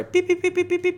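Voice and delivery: very high, quick beep beep imitation; imitating a whistle. Trainer mimicking his whistle for the puppy, very high beeps. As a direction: chirp it like a dog whistle. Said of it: "beep on my whistle".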